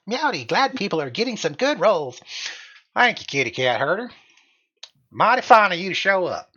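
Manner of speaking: playful cowboy howdy. Pretend. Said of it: "I don't know why I went redneck there".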